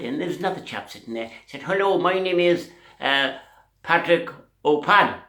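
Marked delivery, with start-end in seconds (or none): 1.6-5.2 loud, higher-pitched Irish introduction; big, bold Irish accent. Acted. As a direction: announce yourself as a comic Irishman. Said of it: "The Irishman and the Scottish accent".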